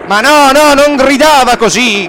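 acuto e forte, quasi gridato; correzione agitata. Acted. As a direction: alza la voce per correggersi. Si corregge prima di imitare. (correzione gridata e acuta)

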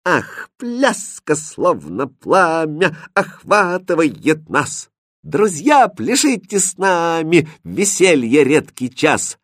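put-on high, slow sing-song girl's verse; Катринка поёт. A narrator's reading. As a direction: lilt it high like a dancing song. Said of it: "Первой начала Катринка". (высокий певучий голос Катринки)

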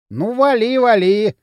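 high, loud jeering send-off; smirking. A narrator's reading. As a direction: dismiss him with a smirk. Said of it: "ухмыльнулся Дрын". (громко, с ухмылкой)

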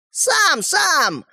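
high, thin little voice; tiny voice. A narrator's reading. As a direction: squeak it out like a tiny toy man. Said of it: "раздался тоненький голосок". (тоненький голосок)

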